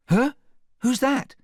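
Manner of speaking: high, loud waking question; startled half-awake. A narrator's reading. Sleeping Beauty half wakes from her doze, startled. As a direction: blurt it high, just roused from a doze. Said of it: "half waking from her doze".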